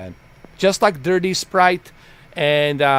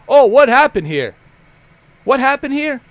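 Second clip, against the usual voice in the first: loud, higher-pitched mimicry of an oblivious latecomer; mock clueless question; 8 semitones higher, 7 dB louder than usual. Streamer mocks a moderator back fifteen minutes late, mock clueless. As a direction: play the surprised returnee, pitched up.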